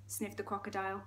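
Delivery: ordinary delivery, nothing marked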